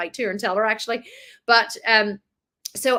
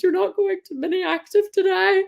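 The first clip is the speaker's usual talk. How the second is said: put-on higher, tearful decree to the kids; defeated announcement; 10 semitones higher, 4 dB louder than usual. Mum telling kids the activity's off, tearful. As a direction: tell them flatly it's cancelled. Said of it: "I said to the kids".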